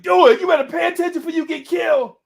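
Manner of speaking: fast, high, loud shouted warning. Retold or imitated. Friend shouts a warning at distracted Dewey, fast and loud. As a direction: yell at him to wake up. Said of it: "I'm like".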